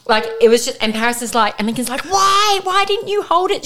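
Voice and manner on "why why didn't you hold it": high-pitched mock wail of protest; outraged child. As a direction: imitate a boy wailing his complaint. Son's outraged wail, acted out.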